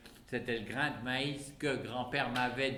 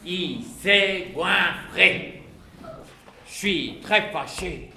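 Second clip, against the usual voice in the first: slow, louder mock fury; mock anger; 3 semitones higher, 6 dB louder, slower than usual. Character declaring he is very cross, slow and louder. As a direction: stamp out each word crossly.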